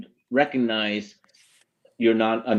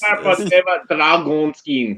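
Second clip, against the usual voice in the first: put-on Russian accent; 5 semitones higher, 6 dB louder, slower than usual. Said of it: "sounds Russian".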